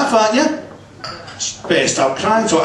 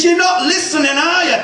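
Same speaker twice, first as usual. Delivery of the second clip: exasperated shout; 13 semitones higher than usual. Retold or imitated. Husband losing patience with his wife, shouting high. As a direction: bellow it in exasperation.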